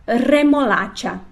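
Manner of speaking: Remolacha slow with a strong rolled R; trilled R. Shown as a demonstration. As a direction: roll the R in Remolacha, slowly. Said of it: "Primeiro o R forte".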